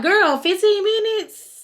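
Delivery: sassy shocked friend calling her out; outraged disbelief. Retold or imitated. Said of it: "So I'm like".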